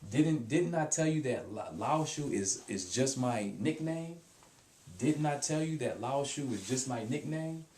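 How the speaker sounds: insist, a little put out; mildly upset reproach. Shown as a demonstration. Teacher acting out a friend annoyed about a nickname. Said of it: "it sounds mean".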